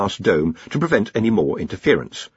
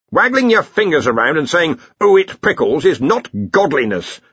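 higher, louder scolding; severe; 7 semitones higher, 4 dB louder than usual. A narrator's reading. Arch-Chancellor rebukes the Dean for claiming godhood, severe. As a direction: put him in his place sternly. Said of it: "said Ridcully severely".

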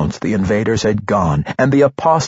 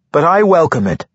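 higher, louder, cheerful monk; light-hearted; 8 semitones higher, 4 dB louder than usual. A narrator's reading. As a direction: welcome her gladly. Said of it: "he said in a light-hearted tone".

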